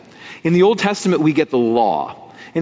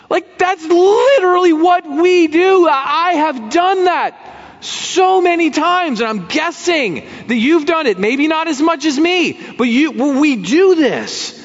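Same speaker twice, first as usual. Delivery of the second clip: higher, driving sermon delivery; impassioned preaching; 4 dB louder than usual. Acted. Preacher urging congregation, impassioned full-force preaching. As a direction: preach it with rising fire.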